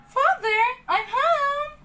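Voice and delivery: high, loud puppet call; calling out home. Acted. Pinocchio calling for his father, high and loud. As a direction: call into the house like an eager boy. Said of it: "Pinocchio called".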